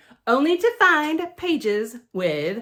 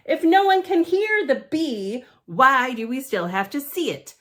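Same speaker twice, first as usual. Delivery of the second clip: usual speaking voice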